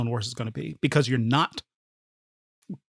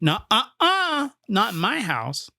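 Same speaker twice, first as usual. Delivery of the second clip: much higher, slow wagging nuh-uh-uh; playful refusal; 7 semitones higher, slower than usual. Retold or imitated. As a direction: deny it like a finger-wagging shot blocker. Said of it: "Dikembe Mutombo'd him and went".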